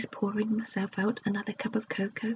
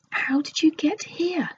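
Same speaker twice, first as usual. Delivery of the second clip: much higher, amazed question; astonished; 8 semitones higher, 3 dB louder than usual. Acted. Joe amazed to see his sisters, asking high. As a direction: ask in disbelief.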